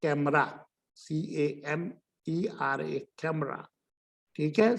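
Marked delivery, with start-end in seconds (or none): 0.0-3.7 कैमरा with a k sound, letters spelled out slowly and softly; slow spelled-out demo. Shown as a demonstration. Teacher slowly spelling out कैमरा. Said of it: "सी के बाद ए आ गया".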